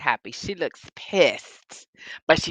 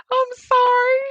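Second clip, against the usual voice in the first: very high, squeaky apology through laughter; loud, high, helpless with laughter; laughing apology; 6 dB louder than usual. Real, not acted. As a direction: apologise while cracking up.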